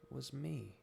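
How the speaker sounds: ordinary delivery, nothing marked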